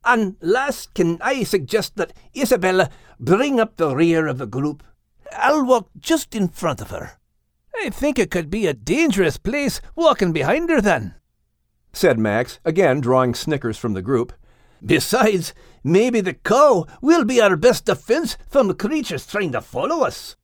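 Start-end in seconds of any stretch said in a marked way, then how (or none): 0.0-11.1 much higher playful character voice; higher, teasing sidekick voice; Max's voice. Acted. Voices Max in dialogue. 14.8-20.4 much higher playful character voice; higher, teasing sidekick voice; Max's voice. Acted. Max continues joking.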